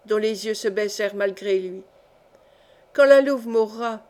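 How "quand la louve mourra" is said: louder, weighty emphasis on "Quand la louve mourra". A narrator's reading. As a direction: lean hard on "la louve" like a prophecy. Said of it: "prononça Jocelyn Guitant avec emphase".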